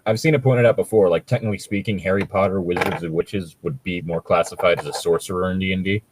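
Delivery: ordinary talk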